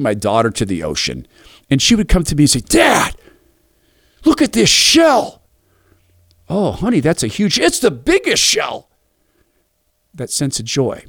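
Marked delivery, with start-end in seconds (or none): 2.6-5.4 put-on high, slow little girl calling Dad; excited child's voice, much higher, dragged out. Daughter voiced in story. 6.5-8.9 mock amazement. Dad replying with mock amazement.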